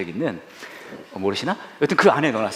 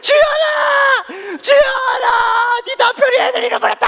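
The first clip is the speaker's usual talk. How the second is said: very high, loud, stretched shriek; hammed-up imitation of mom screaming; frantic calling out; 7 dB louder, much slower than usual. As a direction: yell the bad news across a store. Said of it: "화를 내고 또 계속 불러".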